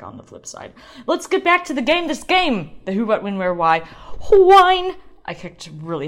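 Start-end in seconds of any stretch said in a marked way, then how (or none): 1.1-3.9 much higher, loud show-host cheer; rally the listeners back to the game; excited callout. Acted. Segment callout. 4.3-5.0 high, loud one-word shout; announcer shout. Acted. Announcing the wine category.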